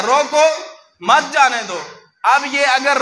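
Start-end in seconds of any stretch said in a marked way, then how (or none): 0.0-1.8 "रोको", a clear stop, "मत जाने दो"; pause after रोको. Shown as a demonstration. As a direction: put the break before मत, not after it. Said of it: "वक्फ करने में फर्क आ जाता है".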